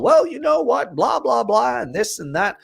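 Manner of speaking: high, lightly voiced chirpy official; high cheery clerk, lightly done; chatty official. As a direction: play a nosy clerk, lightly. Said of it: "the guy from the institution".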